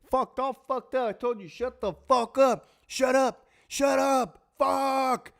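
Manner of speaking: high, strained repeated yelling; hammed-up fit of rage; acted angry outburst. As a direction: yell to shut a partner down. Re-enacts where the quarrel was heading.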